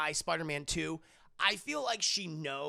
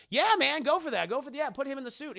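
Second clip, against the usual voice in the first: excited; 10 semitones higher, 7 dB louder, faster than usual. Retold or imitated. Harry eagerly approving Rhino.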